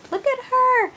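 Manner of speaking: doting coo at a sweet doll. Real, not acted. Cooing over the doll.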